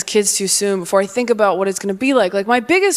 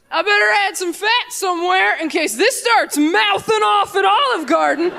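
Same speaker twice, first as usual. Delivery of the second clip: mock-divine shout at the first kid; booming God; 7 semitones higher than usual. God shouting as he designs the first kid.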